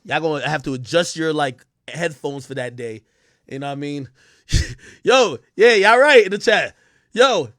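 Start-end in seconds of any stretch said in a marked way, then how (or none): none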